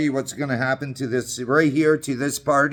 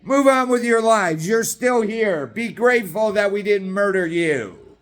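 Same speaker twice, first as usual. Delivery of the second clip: higher, smug mocking official; mock government voice; 5 semitones higher than usual. Ranting man mocks government telling people to move on, smug. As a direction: parody the government talking down to people. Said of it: "the government turns around and goes".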